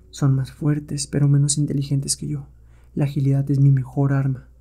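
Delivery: soft, level inner voice; calculating hero. Acted. Hero thinks to himself while facing stronger apes, calculating. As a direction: think it through quietly mid-fight.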